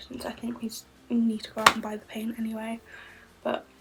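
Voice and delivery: soft unvoiced whisper throughout. Real, not acted. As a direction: keep it hushed, barely voiced. Quiet whispered chat at home.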